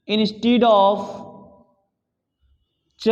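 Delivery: very slow, word-by-word dictation with long pauses; classroom dictation. Real, not acted. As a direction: dictate slowly in short repeated chunks for students to copy. Dictates a note for copying.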